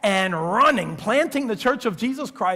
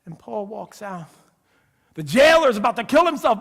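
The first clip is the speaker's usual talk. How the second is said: ordinary delivery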